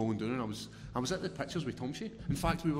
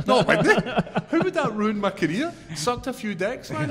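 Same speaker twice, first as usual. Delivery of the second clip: higher and louder, laughing through the words; 10 semitones higher, 10 dB louder than usual. Real, not acted. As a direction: laugh the rumour off loudly.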